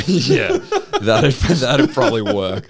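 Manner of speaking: talking through laughter; loud, amused agreement breaking into laughs; laughing. Real, not acted. Cracking up at the bit.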